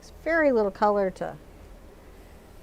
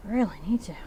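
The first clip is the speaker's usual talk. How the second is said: tired whisper; 4 semitones lower, 7 dB quieter, faster than usual. Real, not acted. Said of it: "My arms are so tired".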